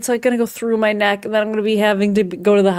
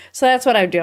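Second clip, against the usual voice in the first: ordinary delivery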